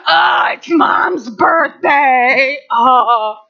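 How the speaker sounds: slow, whiny groan of a bored family; mock family groan. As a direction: drag it out like a dreaded chore. Family dreading mom's birthday.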